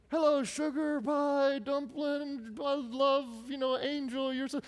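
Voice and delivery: high, slow, sugary mom cooing; mimicking mom gushing over her son; doting mom voice. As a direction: coo at your boy like a doting mother. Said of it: "there's my mom again".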